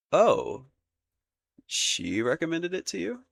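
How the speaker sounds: high, rising surprised question; taken aback. Acted. Prince learning his sister recommended the book, taken aback. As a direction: start with a loud oh, climb in disbelief.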